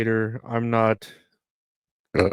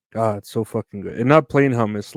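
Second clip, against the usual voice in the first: ordinary talk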